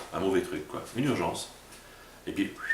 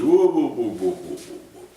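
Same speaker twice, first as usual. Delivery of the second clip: calming whoa; 3 semitones higher, 6 dB louder than usual. Retold or imitated. Preacher slowing down people in a flap, loud whoas dying away.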